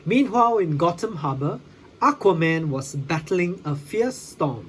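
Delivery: usual speaking voice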